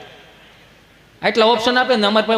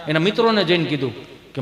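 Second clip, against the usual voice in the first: usual speaking voice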